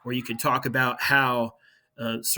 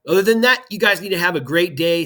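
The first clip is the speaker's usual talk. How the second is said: much higher and louder, bright send-off; cheerful sign-off; 5 semitones higher, 10 dB louder, faster than usual. Real, not acted. Teacher sending students off for a snow day, upbeat and loud. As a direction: lift it up big to wish them well.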